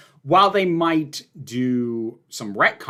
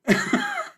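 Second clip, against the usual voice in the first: a short, very high-pitched giggle; high laugh. Real, not acted. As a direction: squeak out a quick laugh. Laughing at own topic dodge.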